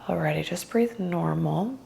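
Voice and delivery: guided meditation. Acted. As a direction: ease them gently into stillness.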